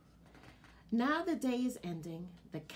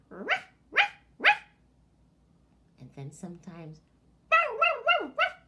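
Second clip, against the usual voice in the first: high loud barks; dog barking; 10 semitones higher, 10 dB louder than usual. Shown as a demonstration. Showing children the dog's sound, loud barking. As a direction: bark like a playful pup.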